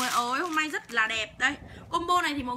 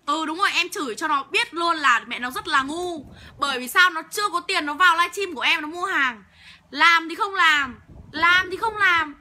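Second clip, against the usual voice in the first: angry cursing; 4 dB louder than usual. Real, not acted. Seller publicly scolding a troll who has no money, angry. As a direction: lay into the heckler, punching the insults. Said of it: "Hôm nay điên máu với chửi đấy".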